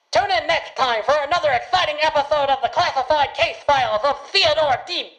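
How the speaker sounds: big, high, loud radio announcer; hammed-up serial announcer; announcer intro. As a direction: boom it out like an old radio show host.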